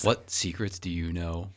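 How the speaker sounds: low, slow hushed question; hushed tones. Pretend. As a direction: hush it like sharing a secret. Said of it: "In very hushed tones".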